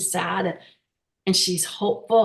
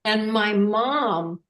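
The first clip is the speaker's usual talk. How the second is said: normal talking voice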